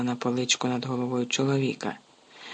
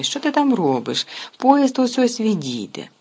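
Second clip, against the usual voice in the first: impatient call; 8 semitones higher, 5 dB louder than usual. Acted. Mother hurrying husband from afar.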